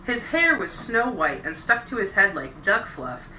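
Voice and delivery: ordinary delivery, nothing marked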